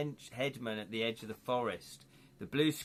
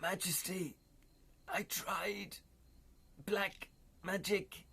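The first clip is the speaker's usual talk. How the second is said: higher, slow, breathy dying gasp; dying armourer; 6 semitones higher, 3 dB quieter, slower than usual. Acted. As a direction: croak out last words through cracked lips. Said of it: "Did you like that acting?".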